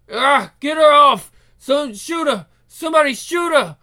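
high, loud cries for help; panicked shouting. Acted. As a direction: yell as if under attack. Attacked man yelling for help.

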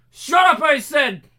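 high, loud raging yell; furious shout. Acted. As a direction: scream it in rage. Enraged captor.

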